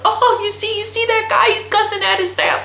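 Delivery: high-pitched imitation of someone relishing the spectacle; mock gleeful onlooker. Visiting attorney delighting in boss cussing at staff, mock gleeful. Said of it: "genuinely enjoying himself".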